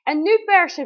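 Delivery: high, loud push command; called out. Retold or imitated. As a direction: shout the order to push. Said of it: "riep Roos". (luid en hoog roepen)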